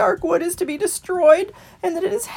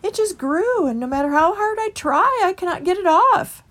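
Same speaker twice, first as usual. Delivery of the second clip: higher, sighing and faltering; sighed; 11 semitones higher, 4 dB louder than usual. A narrator's reading. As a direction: sigh it out sadly. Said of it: "sighed the mouse".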